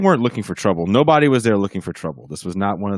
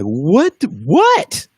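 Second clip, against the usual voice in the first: high rising what what, louder; confused security; 10 semitones higher, 6 dB louder than usual. Retold or imitated. Security arriving confused at the fight, high startled whats. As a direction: sound startled and lost, pitch climbing. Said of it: "security kinda like".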